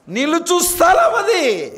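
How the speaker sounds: high, loud, slow recitation; preacher declaiming scripture; impassioned reading. Acted. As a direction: sing out the verse with fervour. Preacher proclaiming.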